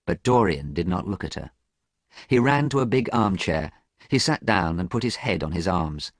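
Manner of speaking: usual speaking voice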